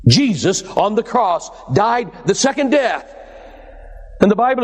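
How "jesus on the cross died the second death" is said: usual speaking voice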